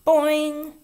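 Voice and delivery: high, loud springy boing cry. A narrator's reading. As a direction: shout a bouncy boing. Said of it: "and yelled".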